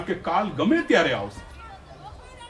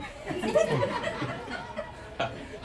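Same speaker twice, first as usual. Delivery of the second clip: silent wheezing laugh, very high; laughing almost without voice; breathy unvoiced laugh; 6 dB quieter than usual. Real, not acted. The preacher laughs at his own joke to the devotees.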